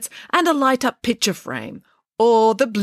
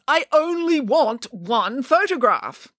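slow, high-pitched insistence; exasperated, drawn-out demand; insistent declaration; 7 semitones higher, slower than usual. A narrator's reading. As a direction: declare it slowly, word by word. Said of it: "declared Nanny Piggins".

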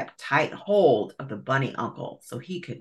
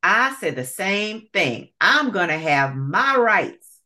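indignant alligator; 5 semitones higher, 4 dB louder than usual. Acted. Alligator demands rights.

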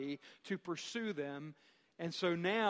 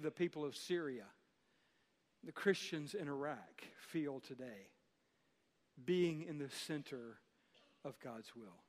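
quiet and grave; 5 semitones lower, 8 dB quieter than usual. Real, not acted. Sombre question about persecuted believers.

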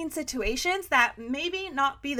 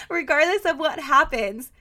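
laughing; 2 semitones higher than usual. Real, not acted. Laughing mid-rant.

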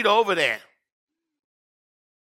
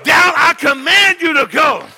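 commanding; 3 semitones higher than usual. Pretend. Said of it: "Somebody need to say".

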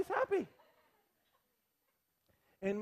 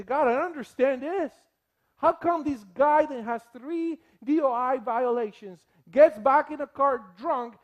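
loud, raised, angry demand of God; indignant outcry; 3 semitones higher, 7 dB louder than usual. Retold or imitated. Modern Habakkuk to God, loud indignant outcry. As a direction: confront God with injustice, heated.

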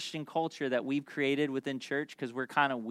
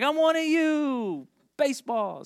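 eager outsider; 11 semitones higher, 3 dB louder, slower than usual. Retold or imitated. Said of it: "talk like him and blend in".

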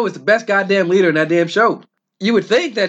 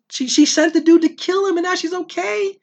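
normal talking voice

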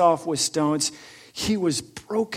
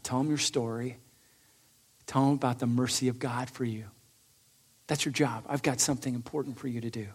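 softer, tender and reassuring; gentle commission; 4 semitones lower, 8 dB quieter than usual. Acted. Jesus gently tells the healed man his mission, soft and tender. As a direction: speak kindly, like sending a friend off.

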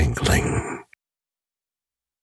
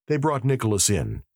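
ordinary delivery, nothing marked

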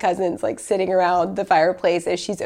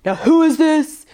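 high, loud mock demand; imitating a brusque Northeast lawyer; gruff lawyer; 10 semitones higher, 6 dB louder than usual. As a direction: bark it like a tough lawyer. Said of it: "he was like".

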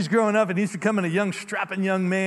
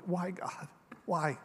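soft, pained plea to God; quiet broken why; hushed anguish; 8 dB quieter, slower than usual. Retold or imitated. Grieving couple asking God why, hushed and pained.

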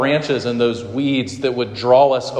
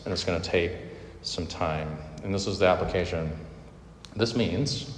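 quiet, gentle pastoral voice, softer; soft tender preaching; 4 semitones lower, 8 dB quieter than usual. Acted. Pastor reassures his congregation about patience, soft and tender. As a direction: speak softly, like comforting a friend.

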